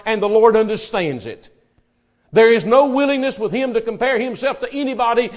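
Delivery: loud, rising sermon cry; preaching at full force. Acted. Preacher pressing the congregation about Peter's shame, full force.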